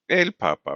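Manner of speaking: "papa" stressed on its first syllable; teacher's model, first pa of "papa" leads. Shown as a demonstration. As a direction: repeat "el papa" as PA-pa, falling. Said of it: "the syllable right before the last one".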